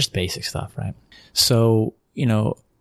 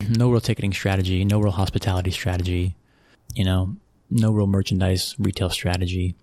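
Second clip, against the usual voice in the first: ordinary delivery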